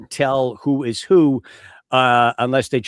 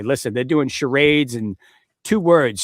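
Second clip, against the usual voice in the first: ordinary talk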